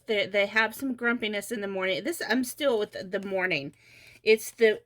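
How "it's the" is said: mid-yawn speech. Real, not acted. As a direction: talk through a yawn. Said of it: "Totally yawning".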